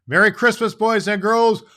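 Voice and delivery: loud, high, booming ho ho ho; jolly Santa farewell. Acted. Santa sending the boys and girls off with a booming laugh. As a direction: shout the goodbye like a delighted Santa.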